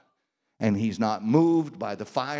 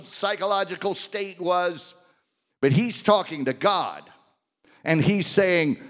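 ordinary delivery

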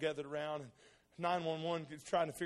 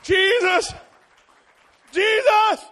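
fervent cry; 20 dB louder, much slower than usual. Retold or imitated. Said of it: "And Danny goes".

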